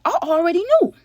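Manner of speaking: high, loud burst of certainty; hyped-up reveal; dramatic exclaim. Real, not acted. Tension as knock comes.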